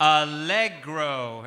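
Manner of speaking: allegro drawn out very slowly and loud, a-LEG-ro with falling end; slow model word. Shown as a demonstration. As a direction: stretch allegro out for the class to copy. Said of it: "Everybody try to say allegro".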